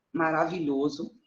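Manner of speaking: ordinary talk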